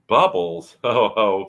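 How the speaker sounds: loud, laughing rat; amused laugh. A narrator's reading. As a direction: chuckle it out heartily.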